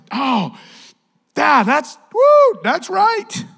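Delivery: high, loud burst of excitement; whooping agreement. Acted. Preacher acts out reacting to the verse, whooping agreement. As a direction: build from an "oh" to a drawn-out, high whoop.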